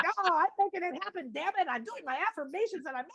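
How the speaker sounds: pushing it through by force; hammed-up stubborn affirmations; stubborn self-talk. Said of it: "and we're like".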